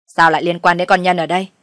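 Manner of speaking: loud, puzzled challenge; sharp questioning. Acted. Father-in-law asking why his daughter-in-law is blamed, sharp questioning. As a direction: press for an explanation loudly.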